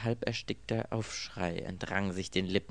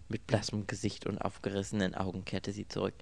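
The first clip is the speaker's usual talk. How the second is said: usual speaking voice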